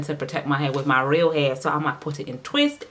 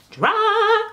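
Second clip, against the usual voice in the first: squealed Dry; 5 dB louder than usual. Real, not acted. Emphasis on dry hair.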